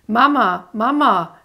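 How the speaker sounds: high, loud desperate cries; screaming for mother. Acted. Amy screams for her mother, high and loud. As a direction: call out for mum in panic. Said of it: "Amy schrie".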